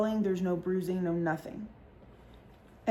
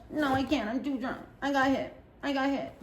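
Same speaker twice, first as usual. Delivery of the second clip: higher, softer mock drunk whine; drunk whimper; 4 semitones higher, 4 dB quieter than usual. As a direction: whimper it from the floor. Drunk friend on floor.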